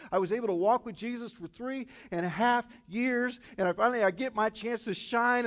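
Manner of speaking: high, loud, worked-up lament; anguished rant. Acted. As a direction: build to a frantic, self-blaming peak. Peter's anguished inner rant, acted out.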